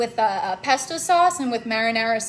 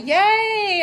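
excited cheer; 7 semitones higher, 6 dB louder than usual. Real, not acted. Cheering a viewer comment.

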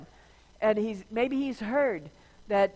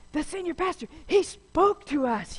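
excited brother; 7 semitones higher than usual. Retold or imitated. Brother gushing that the senior pastor spoke to them, excited. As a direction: gush it like a thrilled little brother. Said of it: "my brother was just so excited".

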